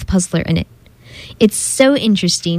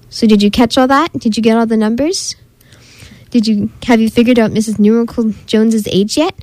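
plain reading voice

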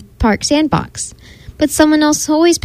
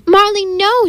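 high-pitched yell, held back in volume; 9 semitones higher, slower than usual. A narrator's reading. Cassie yells to stop the dog at the market, high mock yell. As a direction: cry out high in alarm, without full force. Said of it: "she yelled".

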